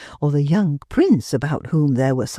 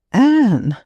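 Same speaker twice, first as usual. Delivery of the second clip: glad recognition; 6 semitones higher, 5 dB louder than usual. A narrator's reading. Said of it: "She barely suppressed the questioning lift".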